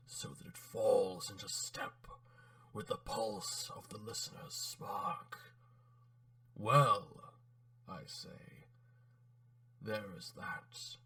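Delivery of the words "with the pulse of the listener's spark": unvoiced menacing whisper. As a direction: breathe the words into the listener's spark. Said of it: "modulate the timbre of your voice".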